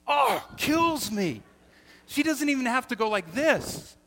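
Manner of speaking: slow, high, loud anguished groan; loud exasperation. Real, not acted. Pastor telling how his wife's remark kills him, loud. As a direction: let it kill you out loud. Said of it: "frustration peaks".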